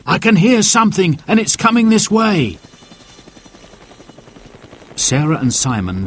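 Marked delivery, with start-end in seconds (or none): 0.1-2.6 sharp hush, then high excited alert; urgent cry. A narrator's reading. Dad hears something coming and cries out urgently. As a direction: cry out high to make them listen. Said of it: "Dad cried out".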